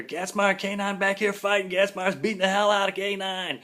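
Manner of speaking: high, loud alarmed shouting across the hall; kids yelling. Retold or imitated. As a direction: yell it like gossiping kids spreading a fight. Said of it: "all of a sudden you hear".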